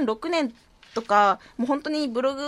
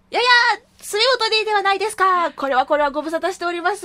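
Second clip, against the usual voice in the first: higher, louder nerdy otaku voice; 6 semitones higher, 5 dB louder, faster than usual. Pretend. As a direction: play a stock anime otaku. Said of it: "テンプレ的なオタクの喋り方".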